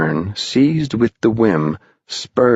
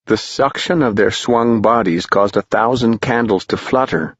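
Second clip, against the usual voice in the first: plain reading voice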